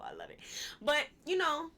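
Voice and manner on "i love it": whispered. Real, not acted. Gushing aside.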